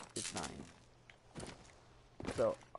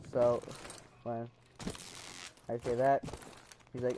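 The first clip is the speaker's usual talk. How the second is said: ordinary talk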